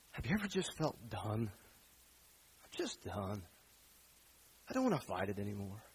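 low, soft and drained; weary, done. Acted. A worn-out listener voiced low and soft, drained. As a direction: sigh it out like someone worn out.

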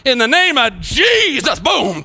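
big, drawn-out evangelist cry with a boom; mock faith-healer shout. Preacher mimicking showy healers to his church, mock shout. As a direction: bellow it like a showy healer knocking people over.

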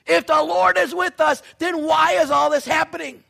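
loud high question; Gideon's complaint. Acted. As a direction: press the question hard. Gideon quoted again.

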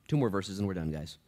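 ordinary delivery

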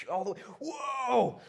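long, high, loud whoa; amazed shout. Acted. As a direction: holler in drawn-out astonishment. Acting amazement at Matthew.

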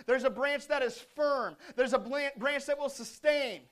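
high, loud, swelling preacher; ringing pulpit delivery, punching the key words; impassioned preaching. Real, not acted. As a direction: preach it at full force, building.

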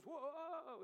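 high, loud, drawn-out whoa; hammed-up panicked wail; mock alarm. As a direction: yelp as if suddenly dropped, half laughing. Paralyzed man jerked on ropes.

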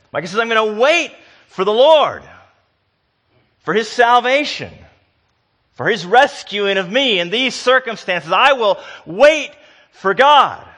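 loud, high, urgent preaching; full-force preaching. Acted. Preacher urging the congregation about waiting, full force. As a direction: drive it home to the pews.

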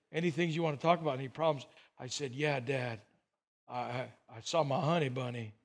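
low, soft and downcast; lightly acted hushed heartbreak; subdued retelling. Boy confessing heartbreak to his dad on their walk, subdued.

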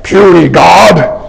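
slow lightly voiced Hulk sneer. Preacher mimicking the Hulk for his congregation, slow and sneering. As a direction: drawl it out like the Hulk, with contempt. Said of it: "So the Hulk took Loki".

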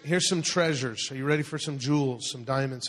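usual speaking voice

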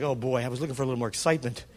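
dry sarcastic mutter; sarcastic aside. Retold or imitated. Preacher voicing his unimpressed thought about John.

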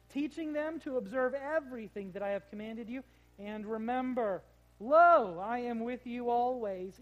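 loud, ringing scripture reading swelling to lo. Acted. Preacher reading the Great Commission to the congregation, ringing and loud.

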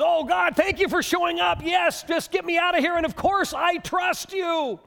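high, loud, rushed gratitude; relieved gushing. Acted. Hanging man thanks God for showing up, high loud relief. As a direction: pour out relief to your rescuer, high and loud.

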